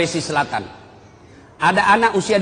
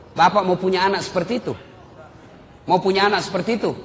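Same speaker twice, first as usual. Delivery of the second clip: normal talking voice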